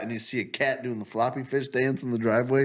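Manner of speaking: ordinary talk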